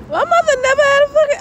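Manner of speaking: high-pitched squeal of the same line. Acted. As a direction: shriek it up high for a laugh.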